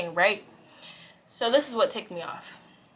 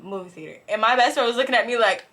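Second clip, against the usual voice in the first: normal talking voice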